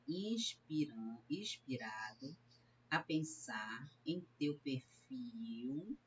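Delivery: slow, drawn-out line of verse; scanning verse. Shown as a demonstration. Teacher showing students the syllable count, slow scansion. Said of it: "olha como eles fazem a contagem".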